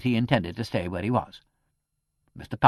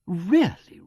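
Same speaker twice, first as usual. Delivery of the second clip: a mildly shocked, higher, louder "really"; scandalized vicar; 5 semitones higher, 6 dB louder, slower than usual. A narrator's reading. A hint of a shocked old vicar. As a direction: vicar protesting the clerk's rudeness, mildly scandalized. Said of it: "cried the vicar, scandalized".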